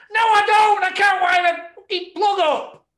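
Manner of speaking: high, loud protest as the trainer; mock indignant shout. Mocking the trainer, a high indignant shout. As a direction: yell it as him, fed up. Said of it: "I'm not my dad".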